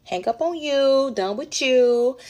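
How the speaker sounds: loud brush-off. Acted. Angrily cutting him off.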